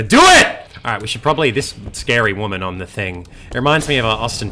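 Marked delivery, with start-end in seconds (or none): 0.0-0.5 shouting. Real, not acted. Rallying cry to find son.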